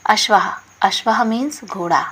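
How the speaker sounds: ordinary delivery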